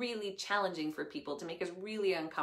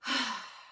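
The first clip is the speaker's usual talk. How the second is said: a long, giving-up sigh; reluctant sigh; 3 semitones higher than usual. Acted. Moses finally giving in to God, a reluctant sigh. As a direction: sigh like you've run out of excuses. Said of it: "And Moses was still like".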